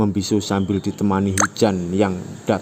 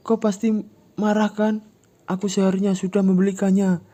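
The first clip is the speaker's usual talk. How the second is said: high, raised, rushing apology; high pleading shout; 13 semitones higher, 4 dB louder than usual. Acted. Ujang pleading with Yura, high frantic shout. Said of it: "Ujang berteriak".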